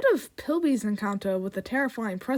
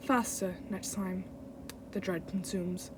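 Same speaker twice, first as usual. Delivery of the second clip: low, soft, sinister voice; menacing villain; 5 semitones lower, 11 dB quieter than usual. Acted. Villain taunting a mortal, low soft menace. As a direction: murmur it darkly and quietly. Continues reading villain lines.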